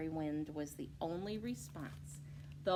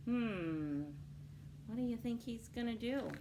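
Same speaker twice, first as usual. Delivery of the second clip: plain reading voice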